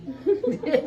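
loud hearty laugh; burst of laughter. Real, not acted. Laughing at her own story. (ਉੱਚੀ ਹਾਸਾ)

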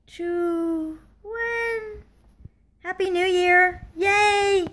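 high, bright New Year cheer; excited cheer. Real, not acted. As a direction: cheer the countdown to the finish. New Year countdown celebration.

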